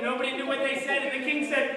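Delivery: usual speaking voice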